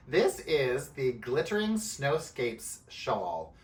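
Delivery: slow, deliberate enunciation; slow, careful. Real, not acted. Knitter introducing a hard-to-say shawl name, slowly and carefully. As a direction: measure out each word of the tongue-twister. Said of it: "say it slowly like that".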